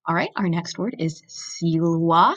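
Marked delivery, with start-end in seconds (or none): 1.3-2.3 silwa with W sound for V. Shown as a demonstration. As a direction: say silwa as sil-wa, V as W. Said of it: "You can hear I'm pronouncing that V like a W".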